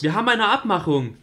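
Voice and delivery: insistent shout. Acted. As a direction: shout the deal at him. Julie insists on deal. (lautes, beharrliches Rufen)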